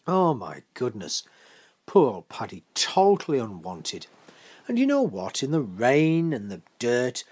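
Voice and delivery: ordinary delivery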